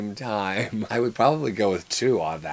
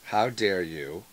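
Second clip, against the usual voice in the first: slow, low, softer "How dare you"; drawn-out catchphrase; 5 semitones lower, 6 dB quieter, slower than usual. Acted. Reading it again for friends, slow low indignation. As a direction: say it slowly and quietly, dropped in pitch. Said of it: "We say that a lot to each other".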